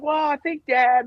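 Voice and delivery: high-pitched retold pleading; mock-flustered son objecting; flustered protest. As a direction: push back at Dad, voice way up.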